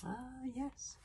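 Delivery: put-on soft, high old-man musing; high, hushed recollection; thoughtful. A narrator's reading. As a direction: muse quietly as the old grandfather. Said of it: "said old Wrinkly thoughtfully".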